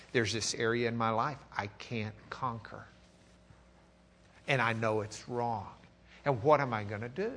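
soft, low, troubled confession; quiet despair. Pretend. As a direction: confess the doubt, hushed and heavy. Voicing the doubting believer's thoughts.

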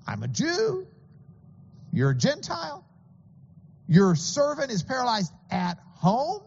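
incredulous Jesus. Retold or imitated. Jesus voiced asking incredulously.

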